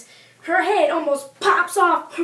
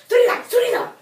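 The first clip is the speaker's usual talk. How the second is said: a stern father, higher and louder; strict father; 8 semitones higher, 6 dB louder, faster than usual. Acted. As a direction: snap the command at the kid. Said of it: "his father goes".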